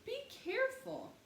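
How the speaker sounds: put-on high child's voice calling out; a small girl warning her brother; high-pitched little sister calling out. A narrator's reading. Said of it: "said Honey".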